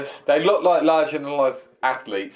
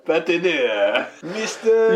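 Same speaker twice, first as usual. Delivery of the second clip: a hammed-up French accent on two words; mock French accent; 2 semitones higher, much slower than usual. Host retries the wrestler's voice for laughs, mock French accent. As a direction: drift into a jokey French accent. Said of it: "you went French then".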